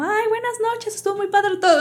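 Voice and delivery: very high sociable goodbye voice; cheery relatives. Retold or imitated. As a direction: imitate relatives gushing good night. Imitating relatives saying good night.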